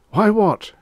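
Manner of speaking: ordinary delivery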